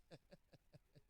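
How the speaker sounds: very quiet, breathy chuckle; soft chuckle. Real, not acted. Preacher amused at title seekers, a soft breathy chuckle. Chuckles after his own remark.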